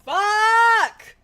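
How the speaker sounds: high loud curse; shout. Real, not acted. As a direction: blurt it out in frustration. Sudden outburst.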